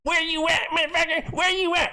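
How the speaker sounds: high-pitched screechy imitation of a ranter; mock ranting yell. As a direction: mimic his yelling, way up high. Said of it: "ranting, raves, and yelling like".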